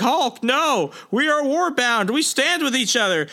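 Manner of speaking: high, urgent plea to stop; pleading warriors. Retold or imitated. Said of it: "They're like".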